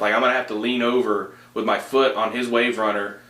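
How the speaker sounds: ordinary talk, nothing marked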